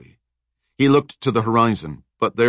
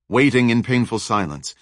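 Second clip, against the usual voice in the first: usual speaking voice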